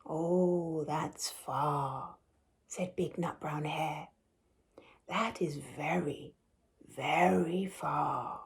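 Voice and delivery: quiet, tender big hare; soft father hare. Acted. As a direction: hush him toward sleep. Father hare softening at bedtime.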